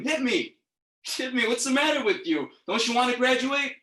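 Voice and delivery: high-pitched, exasperated urging; pleading, impatient dare; insistent goading. Acted. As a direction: egg on a reluctant kid to hit you. Acted goading of reluctant bully.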